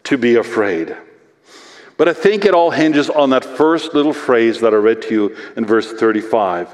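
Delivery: normal talking voice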